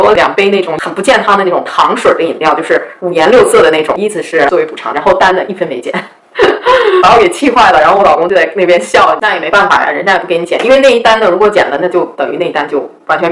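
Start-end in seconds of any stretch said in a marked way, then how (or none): 6.4-7.0 high-pitched burst of laughter; real high laugh; exasperated laugh. Real, not acted. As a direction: laugh helplessly at how absurd it is. Laughing at her own bad compensation story.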